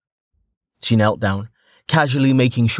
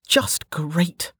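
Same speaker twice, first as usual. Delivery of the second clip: sighing; 8 semitones higher than usual. A narrator's reading. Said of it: "said with a sigh".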